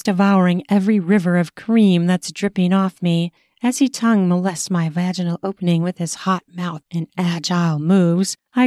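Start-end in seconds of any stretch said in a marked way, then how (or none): none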